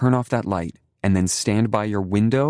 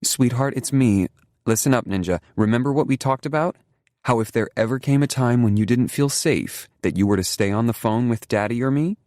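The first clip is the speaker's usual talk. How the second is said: reassuring; 7 semitones higher, 3 dB louder than usual. A narrator's reading. Mother calms child on phone.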